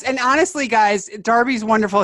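ordinary delivery

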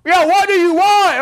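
high, loud, exasperated comeback; mock defiant yell. Son yelling back at the parent calling him, mock defiant.

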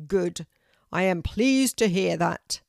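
loud tree voice declaring "Correct"; booming oak tree. A narrator's reading. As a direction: announce it like a great old tree.

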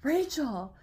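loud, high, drawn-out mock wail; hammed-up exasperated cry, stretching the last word; Maggie's exclamation. Friend blurting out her fear in retold story, wailing.

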